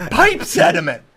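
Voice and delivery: high, laughing repeat of a punchline. Real, not acted. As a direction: crack up as you say it again.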